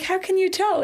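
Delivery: high, curious how-can-you-tell; surprised question. Retold or imitated. New mom to her nurse, high and surprised. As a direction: act amazed that she guessed.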